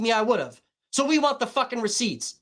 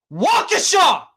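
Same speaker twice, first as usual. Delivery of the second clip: Waukesha! blasted out in disbelief; outraged shout; 8 semitones higher, 6 dB louder, slower than usual. Real, not acted. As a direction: yell Waukesha! high and loud. Outraged rant, shouts the town name.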